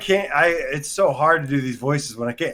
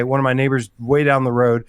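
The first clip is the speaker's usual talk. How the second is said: ordinary talk, nothing marked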